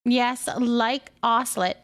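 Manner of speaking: ordinary talk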